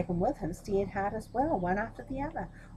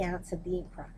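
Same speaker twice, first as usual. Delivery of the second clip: ordinary delivery, nothing marked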